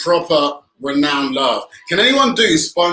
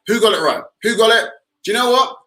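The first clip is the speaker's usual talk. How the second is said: excited; 8 semitones higher than usual. Real, not acted. Announcing quiz winner to viewers.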